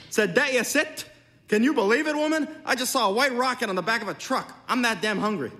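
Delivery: high, loud amazed husband; excited disbelief. A narrator's reading. Husbands rush home to tell wives of a rocket, excited and loud. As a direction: blurt out an unbelievable sight, pitched high.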